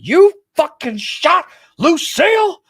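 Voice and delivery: Negan outraged. Retold or imitated. Quoting Negan yelling at the shooter, an outraged slow yell. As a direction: bellow it as Negan losing it over his bat. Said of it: "He's like".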